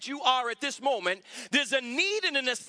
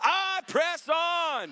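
higher, very slow call; slow shouted declaration; 5 semitones higher, much slower than usual. Real, not acted. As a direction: drag out I press on as a cry. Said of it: "Shout it out loud".

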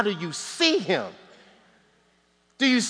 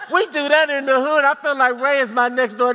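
laughing it out, voice pitched up; 7 semitones higher, 3 dB louder than usual. Real, not acted. Preacher jokes to the congregation, laughing higher. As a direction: chuckle through the line.

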